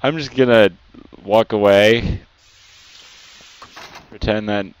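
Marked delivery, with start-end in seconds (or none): none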